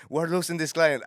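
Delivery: loud, high imitation of a pressed boss; retold alarmed protest. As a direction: protest urgently at high pitch. Manager panics in acted quarrel.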